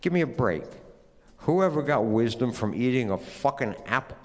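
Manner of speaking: plain reading voice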